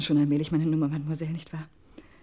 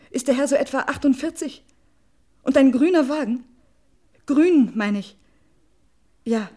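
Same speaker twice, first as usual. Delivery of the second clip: agitated loud questions; 7 semitones higher, 8 dB louder than usual. Acted. Quizzing the landlady about Cécile.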